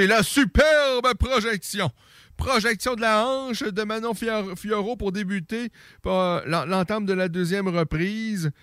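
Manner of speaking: excited play-by-play, pitch way up; wrestling commentator. Real, not acted. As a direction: call the big hip throw at full thrill. Big throw called live.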